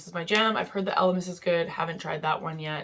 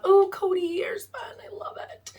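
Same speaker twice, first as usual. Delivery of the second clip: excited whisper; 5 dB louder than usual. Real, not acted. Vlogger spotting her beloved Coty Airspun, in a delighted whisper. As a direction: whisper it like a secret treasure you just found. Fond aside over favourite powder.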